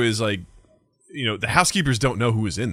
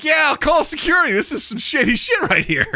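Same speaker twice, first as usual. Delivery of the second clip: high, emphatic retold warning; acting out fed-up hotel clerk; exasperated threat; 13 semitones higher, 4 dB louder than usual. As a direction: warn him off, pitched way up. Threatening security in acted quarrel.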